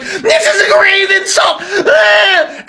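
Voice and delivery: high, shouted fake crying; mock wail. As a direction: wail like an insulted toddler. Mocking Vance wailing.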